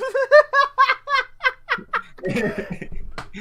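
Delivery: big burst of laughter; cracking up out loud; loud high laugh. Real, not acted. Cracking up at clip.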